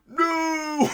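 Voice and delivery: high, overblown villain no; exaggerated movie no. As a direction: stretch the no way up like a hammy villain. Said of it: "I can't even mimic how he said no".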